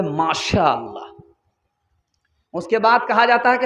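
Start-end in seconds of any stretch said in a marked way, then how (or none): 0.0-1.1 low, slow, drawn-out praise; contented sigh. Acted. Daughter answering her mother, slow and content.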